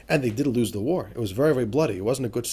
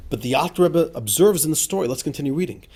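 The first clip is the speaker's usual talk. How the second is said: ordinary talk, nothing marked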